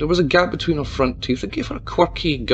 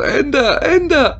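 much higher and louder anguished cry; 13 semitones higher, 6 dB louder, slower than usual. A narrator's reading. Mayor cries out to Tabitha to end it. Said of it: "the mayor cried louder".

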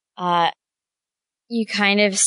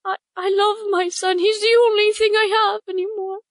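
higher, desperate mother begging; pleading bird mother; 10 semitones higher than usual. Acted. Bird mother begs the adventurers to find her son.

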